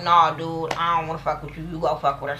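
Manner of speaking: imitating a low, soft brush-off; mock low-key rejection. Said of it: "he look out the window like".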